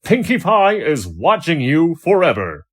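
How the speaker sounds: high, loud proclaiming slogan; bold poster slogan. A narrator's reading. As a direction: announce it like a cheerful propaganda banner.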